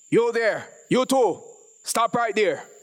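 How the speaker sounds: high, loud barked order; barked command. A narrator's reading. Officer shouting at the boys to stop, barked. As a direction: bark it at the fleeing boys. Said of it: "barked a voice behind them".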